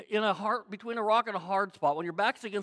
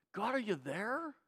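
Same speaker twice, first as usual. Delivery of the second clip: soft, searching question; small, tentative call to God; forlorn prayer; 8 dB quieter than usual. Acted. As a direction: ask quietly into silence, rising at the end. Acted prayer to withdrawn God.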